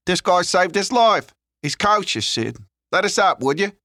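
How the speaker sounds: loud, high-pitched street lad; Leon, urgent. Acted. Figure in narrated story.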